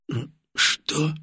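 soft, hoarse croak. A narrator's reading. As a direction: croak it with numb lips. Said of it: "прохрипел Гаврилов".

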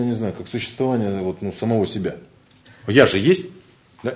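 normal talking voice

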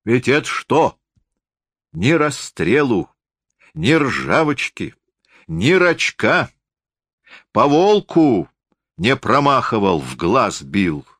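high, slow rustic voice; forester boasting of his gun. A narrator's reading. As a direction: list it proudly, drawing out each item. (лесник хвастает, высоко и врастяжку)

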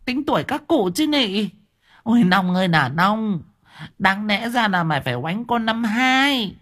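put-on high old woman berating a fool; scolding old woman. A narrator's reading. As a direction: scold him like an exasperated old busybody.

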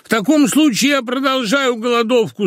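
high, theatrical outcry; crying out. A narrator's reading. Unhappy husband threatens his wife, crying out. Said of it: "закричал несчастный муж".

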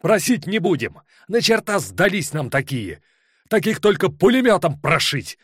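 high, loud, gasping shout; breathless shout. Acted. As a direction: yell it out of breath with rage. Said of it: "Задыхаясь, крикнул он напоследок".

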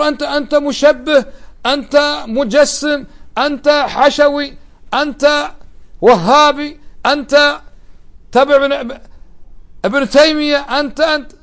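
high, slow accusing voice, name by name; mocking the accuser. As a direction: parody an opponent hurling labels. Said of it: "يأتيك يقول".

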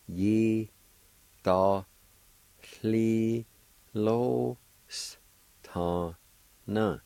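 Yi, to, li, lo s, ta, na each said apart; syllable by syllable. Shown as a demonstration. Teacher sounding out Yi to li lo s ta na for beginners. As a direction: sound out Yi to li lo s ta na piece by piece. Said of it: "is pronounced like this".

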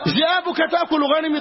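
high-pitched indignant demand; hammed-up fool's protest; mock outrage. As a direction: play the fool outraged his sheep are threatened. Fools quarrel over imagined wolves.

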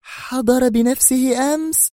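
dumbfounded slow question; stunned. A narrator's reading. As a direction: gasp it out in shock. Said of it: "سقط فكها الأسفل في ذهول".

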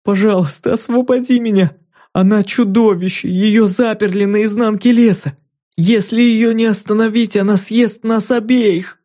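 put-on high, urgent child's plea; pleading little girl. A narrator's reading. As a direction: beg her to free you.

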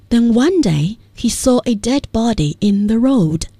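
slow, measured read-aloud of a story; lesson text reading. Acted. Teacher reads the Buddha lesson text to listeners, measured read-aloud. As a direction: read it out phrase by phrase for learners.

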